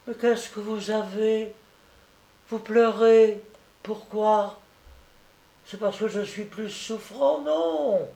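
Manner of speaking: put-on high, pleading little-boy voice; worried affectionate child. A narrator's reading. Sick boy questions his weeping friend, worried and tender.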